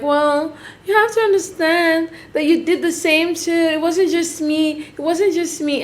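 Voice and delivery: high, soft imitation of a whiny baby voice; mocking baby voice. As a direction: put on a little-girl baby voice, drawn out. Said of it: "her baby voice that she does".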